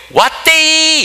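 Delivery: high, loud Daegu granny call; Daegu dialect shout. Retold or imitated. Daegu granny calling out that the bus is here, in dialect. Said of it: "대구 사투리입니다".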